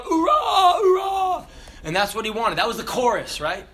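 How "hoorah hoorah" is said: shouted hoorah. Retold or imitated. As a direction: bark it out in formation. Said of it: "and then shout".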